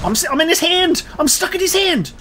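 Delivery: high, loud cry of alarm; panicked yell. Real, not acted. Player stuck in the boss's hand, panicked yell. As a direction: shout it in a real panic. Grabbed by the boss.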